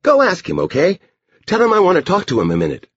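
put-on high, bossy little-girl voice; Edie. A narrator's reading. Girl orders the dog to fetch the man, high voice. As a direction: send the dog off in a high child voice.

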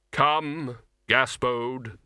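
solemn, weighty summons; firm sepulchral command; Death. A narrator's reading. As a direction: call the soul away as Death.

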